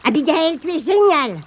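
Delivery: exclamation ravie, bien plus aiguë; s'écrier. Acted. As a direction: crier son admiration, ébloui. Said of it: "s'écria Michef". (Michef félicite Abigail, exclamation ravie et aiguë)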